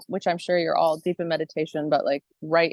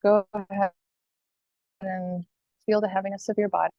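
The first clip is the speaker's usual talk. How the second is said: guided meditation. Acted. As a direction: guide listeners calmly, phrase by phrase, leaving space.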